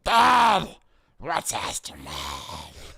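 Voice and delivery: put-on adult doing child voice. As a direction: play a little kid asking dad. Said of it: "I gotta do my kid impression".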